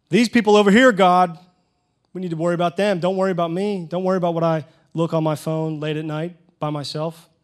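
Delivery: loud, high, mocking self-righteous prayer; smug Pharisee. Pastor mocking a self-righteous churchgoer, loud and smug. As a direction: pray like a smug hypocrite pointing at others.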